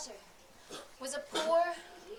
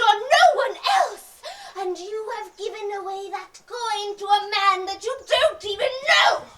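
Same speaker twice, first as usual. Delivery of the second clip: much higher, furious, very loud; angry shout; 6 semitones higher, 15 dB louder than usual. Acted. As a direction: scold him in fury. Golden woman scolds Lutek.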